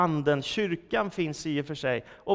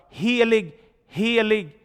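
louder, higher ringing call of holy; angels crying out; 5 semitones higher, 8 dB louder, slower than usual. Acted. Preacher voicing angels before the throne, ringing call. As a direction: proclaim it like angels in the heavens. Angels cry out in Isaiah's vision.

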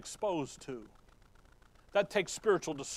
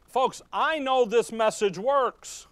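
higher, louder, slow proclamation as Paul; voicing Paul; 6 semitones higher, 6 dB louder than usual. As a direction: declare it like an apostle who won't be silenced. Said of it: "Paul goes".

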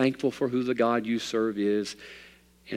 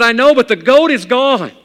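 loud, much higher, bursting with joy; excited; 12 semitones higher, 11 dB louder than usual. Acted. Father of nine to his preacher, overjoyed. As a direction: gush it out, delighted. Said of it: "so excited, so happy".

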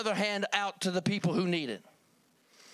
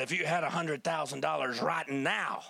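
lightly gruff, lower quoted voice; a touch of gruff man, pitched down; 4 semitones lower than usual. Retold or imitated. Man making an offer to the pastor, lightly gruff. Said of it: "in a gruff voice".

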